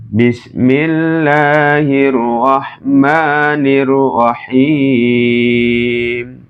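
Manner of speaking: very slow, drawn-out Bismillah with tajwid; Quran recitation. Shown as a demonstration. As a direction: stretch each syllable as in recitation. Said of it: "Contoh sekali lagi".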